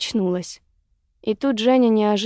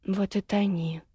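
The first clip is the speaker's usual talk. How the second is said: cold dismissal; 7 dB quieter than usual. A narrator's reading. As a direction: say it softly, leaving her to drown. Leaves the drowning woman.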